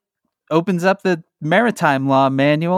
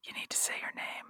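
whispering; 22 dB quieter, faster than usual. Real, not acted. Whispered prompt to cohost.

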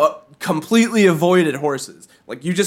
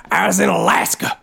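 mock weirdo. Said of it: "you go".

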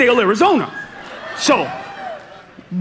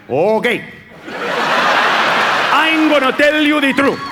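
mock Mexican accent. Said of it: "the brother's side to the cop".

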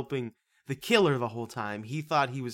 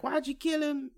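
much higher, innocent question; mocking Emily; 13 semitones higher than usual. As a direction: ask it high and naive. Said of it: "and she's like".

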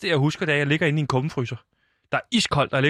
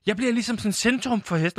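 ordinary talk, nothing marked

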